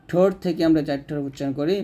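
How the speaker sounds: usual speaking voice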